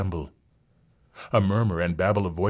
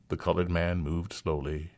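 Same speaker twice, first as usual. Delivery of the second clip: ordinary delivery, nothing marked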